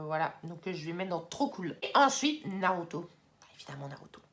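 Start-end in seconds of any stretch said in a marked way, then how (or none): 3.6-4.2 very low, barely audible aside; low, hushed verdict; hushed reverence. Real, not acted. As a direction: drop it right down, as if it goes without saying. Reverent repeat of favourite title.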